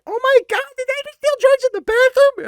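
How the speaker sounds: mock-shrieking kid, very high and loud; shocked freshman. Freshman walking in on a drug deal, high shocked shriek. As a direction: squeal it like a scandalized young freshman. Freshman catches kids dealing in bathroom.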